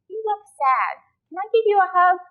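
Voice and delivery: high, cooing sympathy; sympathetic to sad child. Shown as a demonstration. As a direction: offer comfort to a little one. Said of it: "when your child's sad".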